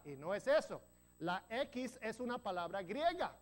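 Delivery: ordinary talk, nothing marked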